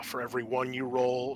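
usual speaking voice

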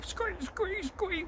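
very high, soft squeaky squeak, squeak; squirrel squeaks. Retold or imitated. Squirrel squeaking at the robber, high and soft. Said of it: "Can't do pheasant noises".

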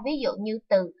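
usual speaking voice

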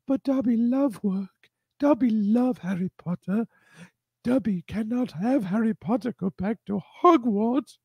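high-pitched house-elf voice; Dobby. Acted. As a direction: put on a high, squeaky elf. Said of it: "this guy's dressed like Dobby".

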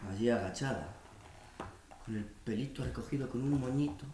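low, very soft intimate voice; tender reminiscence. Acted. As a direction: recall the first meeting softly, lingering. Intimate memory of first meeting.

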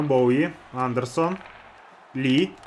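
usual speaking voice

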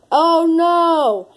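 hammed-up dismay; alarmed. Vlogger dreading the Barney error screen, alarmed.

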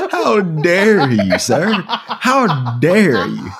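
slow, laughing mock indignation; laughing mock outrage at a cheeky insult. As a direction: pretend to be deeply offended through laughter. Mock offence after teasing.

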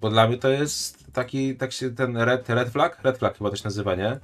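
ordinary delivery

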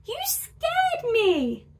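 high, drawn-out squeaky bear voice; acted high squeal of fright. A narrator's reading. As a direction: squeak it out slowly, startled. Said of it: "squeaked the bear".

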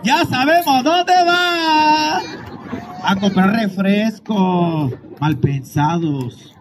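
very slow, drawn-out tease; teasing drawl. Pretend. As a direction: drag it out slowly to tease. Teasing crowd about a couple. (arrastrando las palabras, burlón y muy lento)

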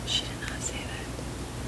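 slow unvoiced whisper. Real, not acted. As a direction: whisper it aside. Teasing aside to the camera.

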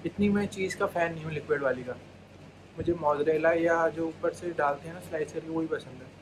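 ordinary talk

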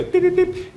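high imitated electronic beeps; satellite beeps. Lecturer mimicking a wellhead signal, high beeps. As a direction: beep like a data transmitter.

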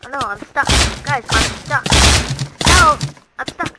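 loud, high cries for help; loud mounting alarm; alarmed shout. Real, not acted. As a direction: call out to teammates, stuck. Stuck in the game, calling teammates.

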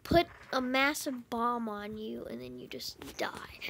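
hushed whisper. Real, not acted. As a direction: whisper it under your breath. Conspiratorial question.

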